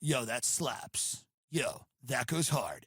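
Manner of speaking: low, slow, soft dude-bro verdict; mock influencer. Podcaster mocking food influencers: slow, low dude-bro voice. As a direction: drag it out low like a lazy food reviewer.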